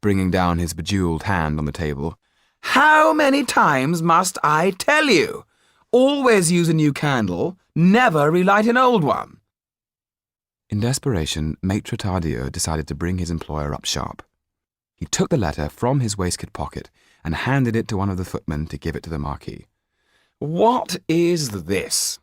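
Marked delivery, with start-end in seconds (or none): 2.6-9.4 much higher and louder, slow indignant scolding; scolding Marquis. Acted. Marquis scolding the footman about candles, high and loud. 20.4-22.2 higher, very slow, drawn-out disdain; disdainful Marquis. Acted. Marquis waving away the lawyer's letter, slow and disdainful.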